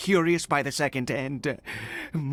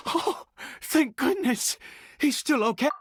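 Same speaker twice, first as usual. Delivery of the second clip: higher, breathless relief; relieved; 8 semitones higher than usual. Acted. Father seeing his son is alive, relieved. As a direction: let the worry drain out in a rush.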